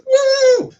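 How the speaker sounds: very high, loud woo; long celebratory whoop; crowd cheer. Acted. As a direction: whoop like a happy bar crowd. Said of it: "Everybody's like".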